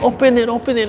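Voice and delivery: put-on high, insistent little-girl voice; toddler demanding. Grandpa voicing toddler pulling the handle, high and insistent. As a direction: pester like a toddler at the freezer. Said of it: "she's like".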